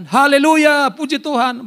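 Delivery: high, loud hallelujah shout; fired-up praise. Acted. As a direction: praise like an eager new servant of God. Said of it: "begitu semangat di awal melayani Tuhan".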